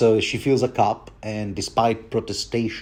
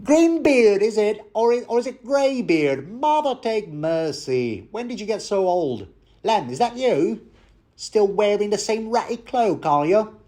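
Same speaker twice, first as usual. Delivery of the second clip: put-on Cockney accent; 10 semitones higher than usual. Innkeeper teasing an old outlaw in a Cockney accent. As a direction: squawk it out as a brash Cockney barmaid. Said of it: "I'm not sure if I can do the accent".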